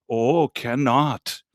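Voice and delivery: slow, drawn-out Thai market seller; Thai vendor imitation. Tourist retelling the market seller's refusal, slow Thai-accented imitation. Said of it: "they'd say".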